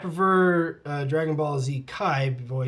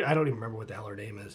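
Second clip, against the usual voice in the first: usual speaking voice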